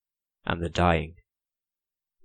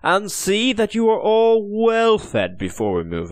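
much higher, louder, smooth promise; false promise; 12 semitones higher, 4 dB louder than usual. Acted. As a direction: sweet-talk the prisoner with a lie. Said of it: "promised Henry Hovenden".

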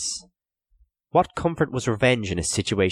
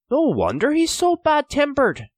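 high, louder joking retort; wry exclamation; 5 dB louder than usual. A narrator's reading. Donal jokes hollowly about the captor, high jibe. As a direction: toss in a raised-voice jibe.

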